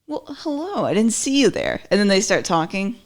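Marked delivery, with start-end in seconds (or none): 0.1-1.8 high, sing-song mock surprise on hello; cheesy TV greeting. Mocking a TV character looking up from work, cheesy. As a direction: ham it up like a corny fifties sitcom. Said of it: "I always felt like that was pretty cheesy".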